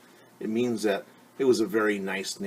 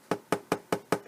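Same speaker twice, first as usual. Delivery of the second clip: throat clearing before disguise. Acted. Wolf prepares to fake a voice.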